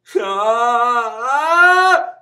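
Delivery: high, loud cry of despair; desperate scream. Shown as a demonstration. Vocal coach demonstrating a desperate scream to students. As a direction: let out a full scream of desperation. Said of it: "Vean, un grito de desesperación".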